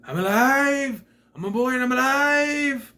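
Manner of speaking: high, loud, slow hammed-up cry of joy; mock a wooden boy thrilled to be alive; ecstatic puppet. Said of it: "he comes to life like this".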